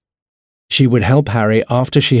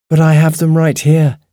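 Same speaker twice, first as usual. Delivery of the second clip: high, louder burst of realisation; 8 semitones higher, 5 dB louder than usual. A narrator's reading. Ruby realising aloud in the darkness, out loud. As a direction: blurt it out, raised and high. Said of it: "she said out loud".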